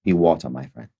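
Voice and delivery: soft, low Bruce Lee catchphrase; a light Bruce Lee voice, quiet and calm; Bruce Lee impression. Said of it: "I also don't just wanna do Bruce Lee".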